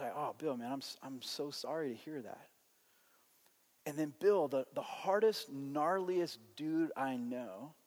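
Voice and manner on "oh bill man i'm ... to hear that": much softer, lower sympathetic voice; hushed condolence. Retold or imitated. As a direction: gently console a grieving friend, retold. Consoling a neighbor whose sister died.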